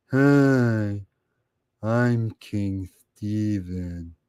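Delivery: slow, low mocking voice; impression of King Steven. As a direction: drag it out, low and dopey. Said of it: "the best impression of his loser ass".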